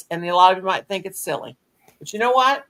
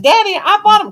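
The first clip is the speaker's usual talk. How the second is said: high, louder protest to dad, retold; 14 semitones higher, 9 dB louder than usual. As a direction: squeal it back at Daddy, louder and higher.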